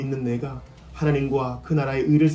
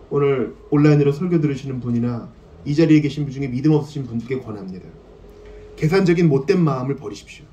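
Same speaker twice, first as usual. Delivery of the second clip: ordinary talk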